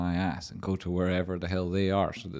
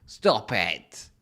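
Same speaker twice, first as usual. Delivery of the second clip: mock scold; 4 semitones higher, 6 dB louder than usual. Scolding the stoned viewer.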